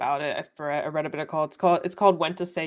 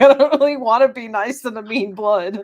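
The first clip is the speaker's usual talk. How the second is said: higher, louder, laughing through it; laughing complaint; 4 semitones higher, 6 dB louder than usual. Retold or imitated. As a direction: giggle while whining about mean relatives. Mock complaint about mean family.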